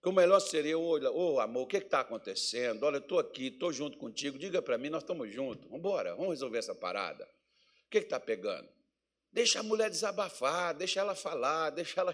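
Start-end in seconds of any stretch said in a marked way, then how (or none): 0.8-8.7 soft, lower, coaxing voice to an upset wife; quiet tender plea to the wife; gentle coaxing husband. Retold or imitated. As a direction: talk her down gently, like a loving husband.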